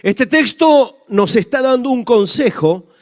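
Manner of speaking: loud, slow, proclaiming sermon voice; fervent scripture reading. Acted. Pastor reading Romans aloud to the congregation, fervent and loud. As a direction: proclaim the verse with full force. Reads scripture then preaches loudly.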